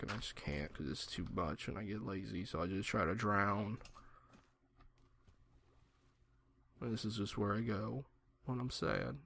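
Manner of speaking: a joking sad confession to the viewers; mock sad. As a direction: play it fake-sad, almost under your breath. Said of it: "and it's sad".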